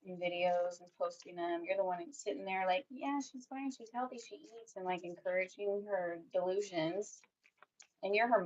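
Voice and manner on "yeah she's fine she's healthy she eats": higher, softer mock of a dismissive mother; mocking the mom. Mocking the mom saying her daughter is fine, high and soft. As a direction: imitate her brushing it off.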